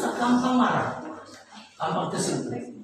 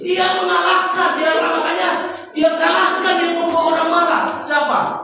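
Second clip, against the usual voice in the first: raised, ringing proclamation; preaching at full force; 7 semitones higher, 5 dB louder than usual. Acted. Preacher proclaims healing to the congregation, ringing and raised. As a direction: call it out over the congregation. Preacher building to sung climax.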